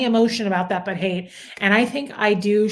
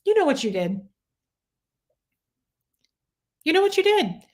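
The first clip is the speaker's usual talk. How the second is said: pointed accusation; 8 semitones higher, faster than usual. Retold or imitated. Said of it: "I look right at 'em and I go".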